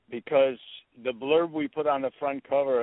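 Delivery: ordinary talk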